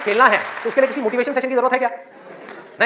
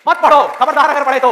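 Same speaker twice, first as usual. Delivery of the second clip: loud, raised, drawn-out warning; stern forbidding; 4 semitones higher, 7 dB louder than usual. Acted. Parents and the world forbidding study, stern shout. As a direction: forbid it loudly, slowly, like a threat. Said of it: "आपको कहे कि मत पढ़ो".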